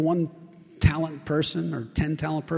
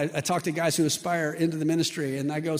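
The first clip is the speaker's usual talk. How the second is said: ordinary talk, nothing marked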